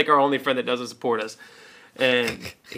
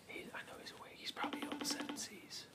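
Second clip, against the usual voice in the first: a soft whisper while the call rings; 7 semitones higher, 25 dB quieter than usual. Real, not acted. Host whispers while waiting for the friend to pick up, whispering. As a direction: keep it hushed, waiting.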